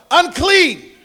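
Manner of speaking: loud, high acted yell; high-pitched shout of warning. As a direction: cry it out like a leper warning people away. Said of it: "he had to yell".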